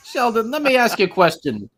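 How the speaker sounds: brash New Jersey guy voice; Jersey fan grilling Sheldon in a thick New Jersey accent. Acted. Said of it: "that atypical New Jersey accent".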